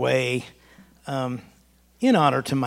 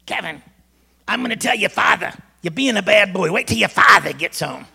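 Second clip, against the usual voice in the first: put-on angry mom in a Massachusetts accent, higher and louder; mom's Massachusetts accent; 5 semitones higher, 7 dB louder than usual. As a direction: scold the bad boy in a Massachusetts accent. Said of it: "that old accent would come out".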